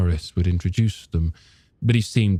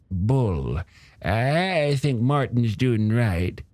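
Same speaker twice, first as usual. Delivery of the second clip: put-on old shopkeeper character; 6 semitones higher than usual. Storekeeper grumbling to a customer about the beaches, high character voice. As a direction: play a hesitant, opinionated storekeeper, pitched up.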